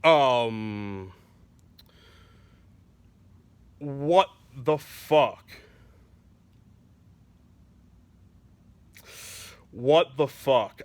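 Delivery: slow, low baffled voice; lightly played dumbfounded manager; stunned disbelief. Walmart manager at the interview, slow stunned disbelief. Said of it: "the Walmart manager is just sitting there like".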